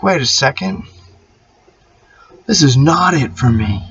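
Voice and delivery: a lightly hammed-up hero waking to his power; sudden defiant realization. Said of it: "I was like".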